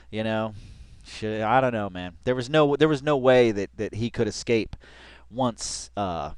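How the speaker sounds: ordinary talk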